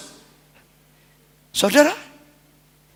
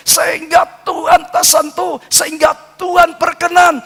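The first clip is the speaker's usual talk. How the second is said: preaching at full force; 7 semitones higher than usual. Acted. Preaching climax, shouted list.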